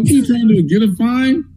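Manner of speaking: high, mocking what-are-you-doing; incredulous scolding. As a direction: tease him for the reckless move. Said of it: "I'm like".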